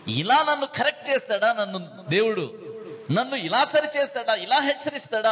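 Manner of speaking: hammed-up hurt outrage, rising in pitch; sulky indignant complaint. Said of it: "ముఖం మార్చుకొని అలుగుతాం". (అలిగిన కోపంతో గట్టిగా)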